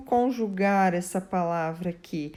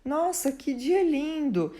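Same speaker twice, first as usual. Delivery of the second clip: admiring exclamation; 6 semitones higher than usual. Shown as a demonstration. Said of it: "abro a janela e digo".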